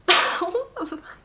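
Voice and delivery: high burst of helpless laughter; sudden high giggle fit; real laugh. Real, not acted. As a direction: crack up at the lawnmower. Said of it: "I'm laughing".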